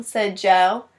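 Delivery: plain reading voice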